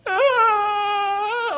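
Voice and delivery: put-on high wailing ah like a frightened baby; imitated baby wail. Pastor acting out his baby daughter's cry on the stairs, high wail. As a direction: mimic the little girl's scared cry. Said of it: "and her face going".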